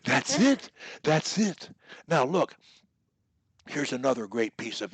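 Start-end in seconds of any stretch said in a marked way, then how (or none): 0.0-1.7 loud, high, punchy praise; excited approval. Real, not acted. Coach to student who bets on himself, excited. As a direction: cheer the right answer.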